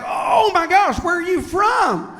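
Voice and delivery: high, loud, drawn-out mock excitement; shouted, hammed-up delighted curiosity; bursting with anticipation. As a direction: gush like an overexcited waitress. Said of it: "she was vibrating with anticipation".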